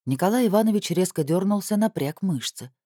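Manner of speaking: plain reading voice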